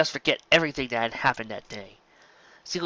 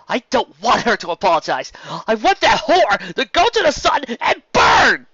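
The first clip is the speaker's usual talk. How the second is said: furious shout; 10 semitones higher, 9 dB louder than usual. A narrator's reading. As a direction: scream your hatred at the filly. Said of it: "stamping her hose in anger".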